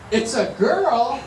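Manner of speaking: says it through a laugh, slowly; laughing in disbelief; laughing announcement. Retold or imitated. Wife's surprised announcement, laughing.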